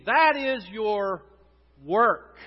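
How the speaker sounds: slow and loud, leaning on work; emphatic sermon point. Real, not acted. Preacher driving home to the congregation, slow and loud. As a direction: hammer the word work.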